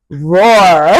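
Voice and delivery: loud, drawn-out mock roar; playful lion roar. Motivator telling viewers they are lions, playful roar.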